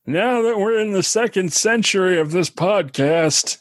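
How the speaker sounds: put-on high, slow toothless old man. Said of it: "Where, where are my teeth?".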